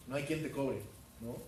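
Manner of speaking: normal talking voice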